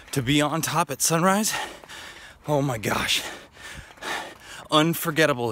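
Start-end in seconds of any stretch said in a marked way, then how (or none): none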